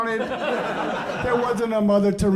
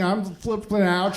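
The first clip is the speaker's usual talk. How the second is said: ordinary delivery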